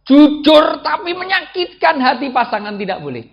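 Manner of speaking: loud, high emphatic preaching; full-force preaching. Real, not acted. Preacher warns wives against hurtful honesty, forceful preaching. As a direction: drive the point home at full volume.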